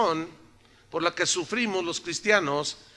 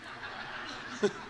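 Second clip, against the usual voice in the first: soft laugh; 11 dB quieter than usual. Real, not acted. Pastor laughs at own joke.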